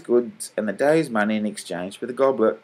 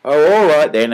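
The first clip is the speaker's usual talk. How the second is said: louder, higher sulky grumble; 6 semitones higher, 9 dB louder than usual. A narrator's reading. Mean salesman grudgingly agreeing, sulky. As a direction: give in sulkily. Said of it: "agreed Mister Johnson sulkily".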